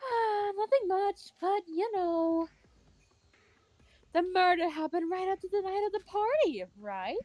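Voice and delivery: lightly voiced easygoing hostess. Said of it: "did I get her voice right?".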